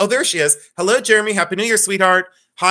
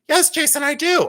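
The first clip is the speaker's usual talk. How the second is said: much higher, mock voice of the friend; imitating Becky; 11 semitones higher than usual. Host answers for friend Becky in high mock voice. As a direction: answer in a squeaky put-on Becky voice. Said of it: "Now that don't even sound like me".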